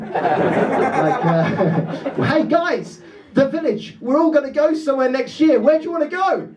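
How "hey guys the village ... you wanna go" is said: mock village leader. Village leader calls meeting.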